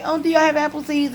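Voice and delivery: high-pitched pretend customer query; mock shopper's question. Shopper asking store for apple seeds, mock inquiry. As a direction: ask it in a put-on naive shopper's lilt.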